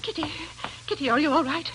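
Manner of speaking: alarmed call. Acted. Aunt bursts in, alarmed.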